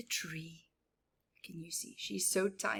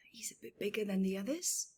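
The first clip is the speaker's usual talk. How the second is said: fast, hushed aside; quick whisper; 5 dB quieter, much faster than usual. Real, not acted.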